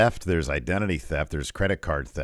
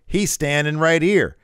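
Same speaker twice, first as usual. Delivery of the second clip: excited quote; 11 semitones higher, 7 dB louder than usual. Retold or imitated. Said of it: "He's like".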